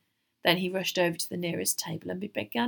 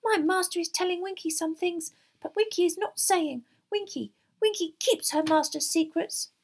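high, squeaky, huffy elf; high-pitched loyal servant elf; secretive house-elf; 11 semitones higher, 5 dB louder than usual. Acted. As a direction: refuse primly in a high elf voice.

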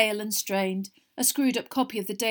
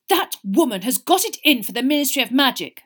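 loud, higher indignant outburst; furious; 5 semitones higher, 11 dB louder than usual. A narrator's reading. Son ranting about the reporter, furious. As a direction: rant in outraged indignation. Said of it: "said Percy furiously".

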